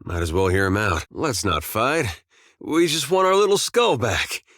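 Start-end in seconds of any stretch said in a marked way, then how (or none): 2.6-4.4 higher-pitched doting voice; fond cooing. Acted. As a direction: coo over a long-lost little friend. Coaxing and doting on little skull.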